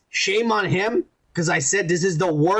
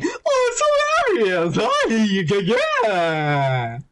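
goofy villain; much slower than usual. Retold or imitated. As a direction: play a giggly jokester. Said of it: "he would be like".

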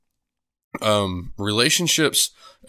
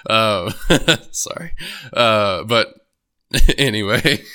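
real laughter breaking through, louder bursts; can't stop laughing, then apologize; laughing fit; 4 dB louder, slower than usual. Real, not acted. Laughing fit mid-sentence.

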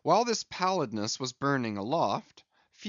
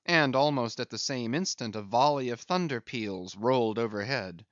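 ordinary delivery, nothing marked

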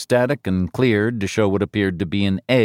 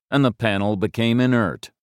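ordinary delivery, nothing marked

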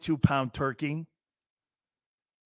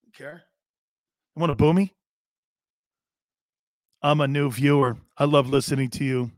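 mock Baker in Philly; 3 semitones lower, 3 dB louder than usual. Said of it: "Watch this".

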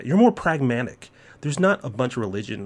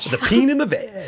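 higher, louder hammed-up title; mock title; 12 semitones higher, 4 dB louder than usual. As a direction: announce it grandly.

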